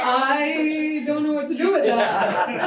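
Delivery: amused, stumped retelling; laughing bafflement. Retold or imitated. Her reply to popcorn and grass, laughing. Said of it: "And I was like".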